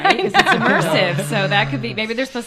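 loud, laughing words. Real, not acted. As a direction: laugh right through the line. Joking about crying in the show.

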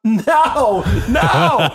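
high, loud laughing no, no; tossing off the coach's question with glee; gleeful high no. Retold or imitated. Player laughing off his coach, gleeful and loud.

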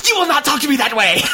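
high, indignant mock shout; acting out an unstable villain's outburst; hammed-up yelling. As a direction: explode into an over-the-top outraged yell. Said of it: "every time he yells".

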